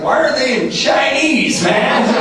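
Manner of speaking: high, drawn-out comic complaint; mock bafflement. Graduate unable to read the steps, mock bafflement. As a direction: protest it high and slow, hammed up.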